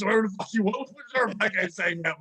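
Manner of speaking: ordinary talk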